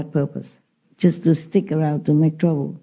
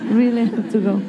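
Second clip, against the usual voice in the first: higher, plaintive little dog asking; pleading dog voice; 5 semitones higher than usual. Retold or imitated. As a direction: voice the dog begging not to go. Dog's pleading voice, retold.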